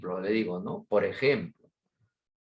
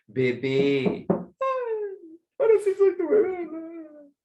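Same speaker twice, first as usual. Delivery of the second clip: mock crying daughter; 5 dB louder, much slower than usual. Daughter crying, being coaxed.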